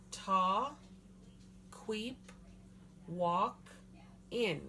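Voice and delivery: "Ta, queep, walk, in", separated syllables with short pauses; sounded out. Shown as a demonstration. Teacher repeating "Ta, queep, walk, in" for the class, sounded out. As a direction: say "Ta, queep, walk, in" one piece at a time. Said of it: "I'll sound that out for you".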